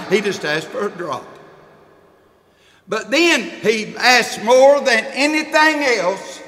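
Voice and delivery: loud, rhythmic sermon cadence with each phrase hammered; full-force preaching. Acted. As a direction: preach it, hit every line harder. Preaching on the rich man.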